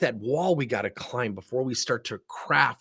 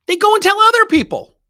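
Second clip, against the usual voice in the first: exaggerated punchline; 13 dB louder than usual. Real, not acted. Punchline, high loud held.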